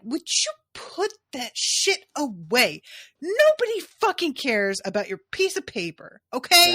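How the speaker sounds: scolding wife. Acted. Wife shutting up Lord Emmon over his decree, scolding. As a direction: play a fed-up wife cutting her husband down.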